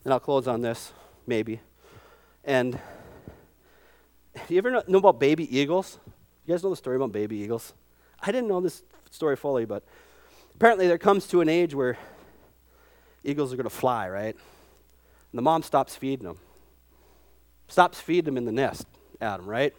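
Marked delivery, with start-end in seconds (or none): none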